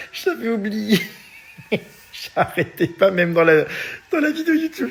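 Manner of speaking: laughing. Real, not acted. Fou rire en se souvenant.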